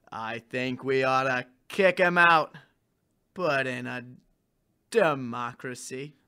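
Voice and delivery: low, slow cowboy drawl; John Wayne. Acted. Rebel suggests ousting the king, slow Wayne drawl. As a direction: pace it like a western hero. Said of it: "talking like John Wayne".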